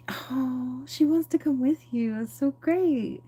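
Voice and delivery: high, slow sugary angel; sweet angel voice. Retold or imitated. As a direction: coo it like a little angel. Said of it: "the angel's like".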